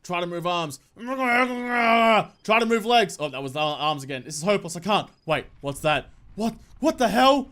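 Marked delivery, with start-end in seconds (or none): 1.0-3.2 very slow strained cry of effort; agonised stretched-out shout; straining cry. Acted. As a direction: force the words out like you can't move. Straining against sleep paralysis.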